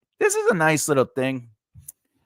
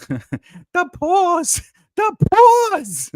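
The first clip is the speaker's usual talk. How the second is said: laughing pet coo; 8 dB louder, much slower than usual. Real, not acted. Gushing over the paws, laughing.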